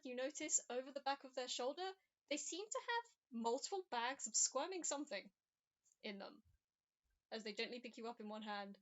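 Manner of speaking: ordinary talk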